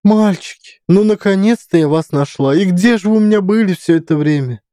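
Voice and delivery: put-on high, bleating old woman. A narrator's reading. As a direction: bleat like a fussing old lady. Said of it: "постарчески блея".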